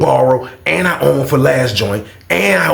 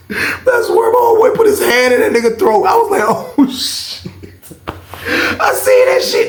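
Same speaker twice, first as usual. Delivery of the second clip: high laughing, breaking into a squeal. Real, not acted. Recalling his boy's hand down the throat, laughing hard.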